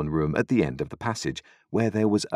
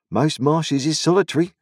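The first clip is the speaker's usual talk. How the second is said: Joe's voice; 9 semitones higher, 5 dB louder than usual. A narrator's reading. Joe voiced in story.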